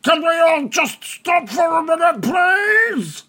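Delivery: high, strained character shout; exasperated goblin boss's high shout; crying out. Acted. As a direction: cry out to halt a fight, high and loud. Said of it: "Bargle cries out".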